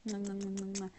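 two quick kiss sounds into the mic; blown kisses. Real, not acted. Host blowing kisses to her live viewers, affectionate smacks.

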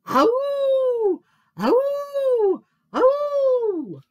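high, loud drawn-out howl; wailing wolf-cub call; awful howl. A narrator's reading. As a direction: howl long, falling at the end. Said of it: "came the awful".

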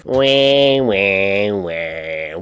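drawn-out fake wail, sliding downward; mock crying wah. Viewer jeering at a thankful YouTuber, mock wailing. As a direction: whine out a sarcastic baby cry. Mocking the crier's thankful speech.

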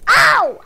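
loud, high "ow!"; pained yelp. Real, not acted. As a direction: yelp as if just hit. Hurt in the game.

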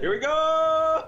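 excited. Real, not acted. Game starting, hyped call.

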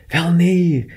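high, playful cry of denial; exclaimed protest. A narrator's reading. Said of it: "riep ze".

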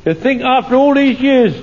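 normal talking voice

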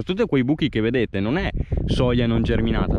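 ordinary talk, nothing marked

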